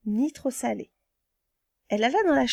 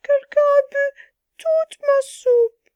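very high, slow, loud wailing cub; crying little bear; 7 dB louder, much slower than usual. Acted. Little bear finding his soup gone, high slow wail. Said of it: "Le petit ours pleura".

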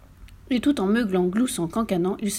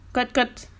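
short double cluck; hen cluck; 3 semitones higher than usual. A narrator's reading. Hens chasing the farmer over hills, brisk clucking.

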